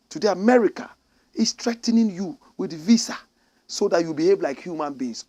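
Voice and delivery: ordinary delivery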